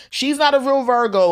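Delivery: high, loud mock call to the street; imitating mom hollering it down the block. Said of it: "go up and down the block in Harlem and say".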